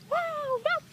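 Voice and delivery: high delighted wow; excited. Real, not acted. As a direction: squeal with delight at the duck. Excited over the duck card.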